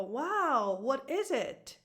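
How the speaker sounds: admiring "wow", pitch swooping high on the question; impressed parent. Acted. Mother reacting to her son's drawing, impressed wonder. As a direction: marvel at a child's drawing. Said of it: "he shows me".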